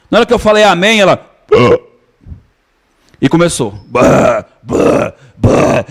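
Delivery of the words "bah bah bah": short mock burping sounds; imitated burps. Pastor mimics the woman burping for the congregation, mock burps. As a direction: burp it out three times, hammed up. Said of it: "uns cinco minutos arrotando".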